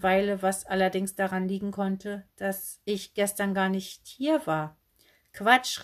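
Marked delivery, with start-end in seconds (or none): 5.3-5.8 louder, higher exclamation; indignant call. A narrator's reading. Girl contradicts the saleswoman, calling out indignantly. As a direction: call out in protest. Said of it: "rief Flummi".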